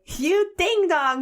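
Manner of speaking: slow, high mock insult; son imitating mom. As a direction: imitate a child copying a parent's road rage. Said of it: "my son has started calling my daughter that".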